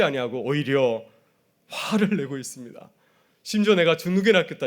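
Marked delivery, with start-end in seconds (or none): 3.7-4.7 protesting. Retold or imitated. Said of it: "이렇게 성토까지 하고 있습니다".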